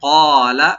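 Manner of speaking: ক্বালা with a deep throaty ক্ব and one alif stretch; loud, deep qaf then long a. Shown as a demonstration. Teacher demonstrates deep qaf loudly. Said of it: "কফটাকে deep করে এক আলিফ টানবেন".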